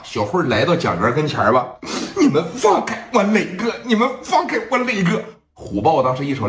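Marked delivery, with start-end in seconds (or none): 2.2-5.2 put-on high girlish plea; high pleading girl voice. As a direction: beg them to let go in a young girl's voice.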